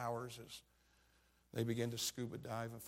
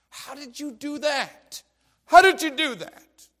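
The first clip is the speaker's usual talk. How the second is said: much higher and louder, slow pleading cry; anguished plea; 12 semitones higher, 19 dB louder, slower than usual. Retold or imitated. Preacher crying out to the bereaved father in disbelief, loud anguish. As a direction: cry it out as if you can't understand.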